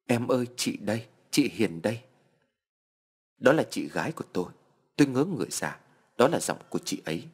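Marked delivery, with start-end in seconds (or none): none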